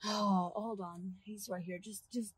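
low, soft heads-up; hushed warning. Acted. Driver warning passenger as their friend appears, hushed. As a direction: drop your voice so he won't hear.

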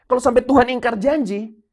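loud, high, ringing proclamation; preaching at full force. Acted. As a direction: preach it out to the whole church.

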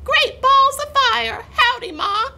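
very high, whooping newborn voice; baby goose. A narrator's reading. Newly hatched gosling greeting the cat, excited baby voice. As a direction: exclaim it high as a tiny gosling. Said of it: "baby goose who looked up".

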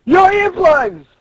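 high, loud, drawn-out call; exasperated shout. Acted. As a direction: shout to people who can't hear. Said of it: "And he's like".

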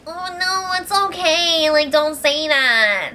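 high put-on soothing voice; imitating an over-sweet comforter; mock consoling. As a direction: coo it high, drawing out the end. Mock-acting a friend's consoling words.

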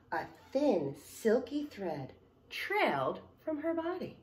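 loud, lively picture-book narration; story reading to children. Acted. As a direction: read it out to a room of kids. Reads picture book aloud.

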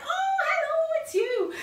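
very high, loud squeal of recognition; hammed-up, whooping hello; delighted greeting. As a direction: act out suddenly recognising a friend. Delighted recognition of a friend, retold.

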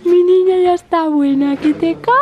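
high, loud doting voice. Real, not acted. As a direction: gush over the little one. Doting on the baby.

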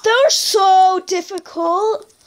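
high, very slow whine; whiny voice. Acted. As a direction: drag it out in a whine. Whining about baby zombies.

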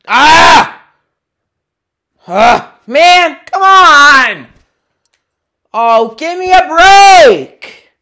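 loud, high furious yells at the game; real rage screams; furious shouting. Real, not acted. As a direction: blow up at the lost game, yelling.

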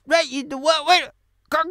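ordinary talk

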